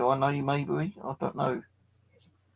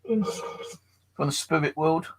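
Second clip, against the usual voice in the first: mock séance medium; 4 semitones higher, 3 dB louder than usual. Mock séance call to spirits.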